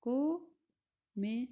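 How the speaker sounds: comedy stretched out slowly; teacher slowing down to show the syllables; slow syllable demo. Shown as a demonstration. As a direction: spell out comedy by its beats, slowly. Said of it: "In comedy we have three syllables".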